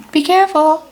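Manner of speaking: high, loud mock parent warning; sing-song nagging parent; lightly voiced parent. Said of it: "How parents always say".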